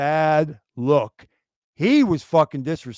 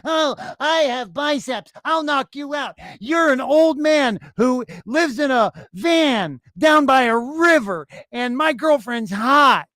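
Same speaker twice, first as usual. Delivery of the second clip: slow, higher sneering mock voice; mocking the braggart; 4 semitones higher than usual. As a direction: parody a dim show-off bragging. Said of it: "that sand started gushing out".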